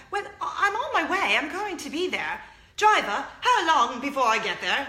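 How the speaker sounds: high, loud mock-British lady; bad British accent. Lady B flustered, calling to her driver, bad British accent. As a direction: put on a hammed-up British accent. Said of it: "speak British just for the sake of".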